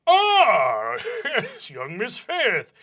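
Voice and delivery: bright, slow, pleased greeting with a laugh; cheerful vice principal. Acted. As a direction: greet a favourite student warmly.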